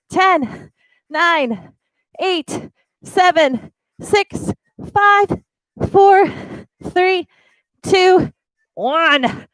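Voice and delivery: high, pumped-up countdown; energetic countdown. Real, not acted. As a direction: count them home with energy. Coach counting down the plank.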